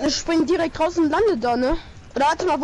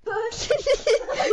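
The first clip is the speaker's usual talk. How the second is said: laughing; 6 semitones higher, 4 dB quieter than usual. Real, not acted. Laughing at Peter.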